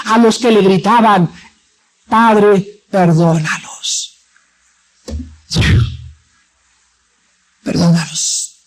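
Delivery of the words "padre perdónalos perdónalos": forgive them gently, from the cross; tender plea. Acted. Jesus on the cross asking the Father to forgive, hushed and tender.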